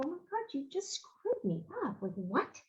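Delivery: high, hushed, thrown off; stunned disbelief. Retold or imitated. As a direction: caught off guard, voice up high and soft. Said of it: "And I go".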